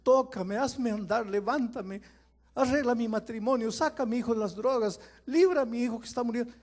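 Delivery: loud, high begging cries; high loud pleading to the Lord; desperate prayers. Acted. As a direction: plead like someone in desperate need.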